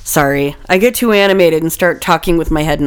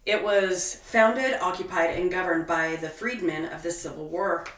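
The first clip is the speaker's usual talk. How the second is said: much softer, halting read; stumbling reading; 14 dB quieter than usual. Real, not acted. A host stumbling over her own script, halting and much softer. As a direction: trip over the words quietly. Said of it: "I'm having a hard time".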